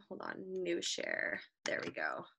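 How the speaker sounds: whispered aside to self; whispering. Real, not acted. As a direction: breathe it quietly under your breath. Muttering to self while sharing screen.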